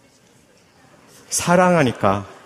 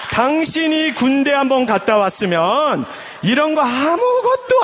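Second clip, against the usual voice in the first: higher, preachy know-it-all husband; lecturing husband; 5 semitones higher than usual. Acted. Husband brushing off his tired wife, condescending lecture. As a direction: talk down to her like a senior at work. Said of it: "이렇게 반응하는 거예요".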